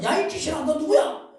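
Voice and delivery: high, loud rebuke of a demon; stern rebuke. Acted. Preacher showing how to rebuke a demon, loud and stern. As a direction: command the spirit to name itself. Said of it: "라고 꾸짖어".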